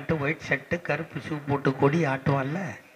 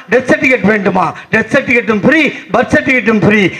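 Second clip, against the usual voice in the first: raised, louder rapid-fire questions and answers; impassioned rally oration; 6 semitones higher, 12 dB louder than usual. Acted. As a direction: build the list with full rally force. Monologue builds in force.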